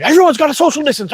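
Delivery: high-pitched imitation of panicking management; mock freak-out. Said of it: "they're freaking out at everybody".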